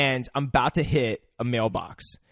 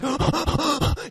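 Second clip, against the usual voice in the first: high, repeated panicked gasps; big high gasps, imitating a scared friend; mock scared gasps; 13 semitones higher than usual. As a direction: gasp sharply in mimed fright.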